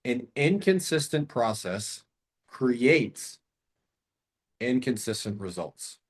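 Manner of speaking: slow and weighty; slow, deliberate. Shown as a demonstration. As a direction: spell it out slowly. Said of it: "write down that".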